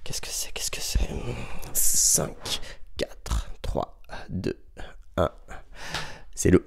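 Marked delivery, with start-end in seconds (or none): 0.0-1.0 hushed whisper to the class. Shown as a demonstration. Teacher whispering the riddle question to pupils. 1.7-5.3 soft whispered count; whispered countdown. Shown as a demonstration. Teacher whispering a countdown while pupils think.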